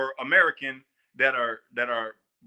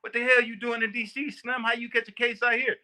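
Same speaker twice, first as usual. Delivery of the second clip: surprised D C inmate; 8 semitones higher than usual. Retold or imitated. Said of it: "He goes".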